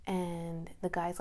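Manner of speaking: normal talking voice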